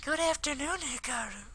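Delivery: bright high-pitched schoolgirl voice; high girl voice. Acted. Voicing female character Akiko.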